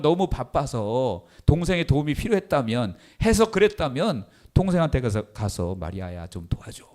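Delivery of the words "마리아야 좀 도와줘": soft, lower, kind ask; gentle request. Retold or imitated. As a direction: ask your sister gently for help. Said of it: "그럴 수 있잖아요".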